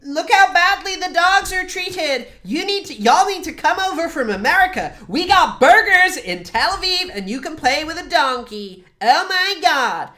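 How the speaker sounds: mocking propaganda ad, hammed-up American voice; mock Israeli propagandist. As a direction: ham up a gushing tourism pitch. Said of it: "donkeys and were like".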